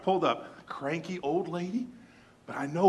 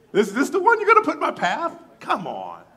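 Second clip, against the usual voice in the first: high, loud, laughing disbelief; mock outrage, pitched way up and laughing; exasperated laughing plea; 11 semitones higher, 11 dB louder than usual. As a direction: throw your hands up at God, laughing. Exasperated plea to God, retold.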